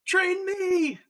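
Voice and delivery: high, loud shout of an eager fan; shouted plea. Retold or imitated. Guy in a car yelling at a street flipper, high loud shout. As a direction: yell out begging to be trained. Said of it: "that one video where the guy's".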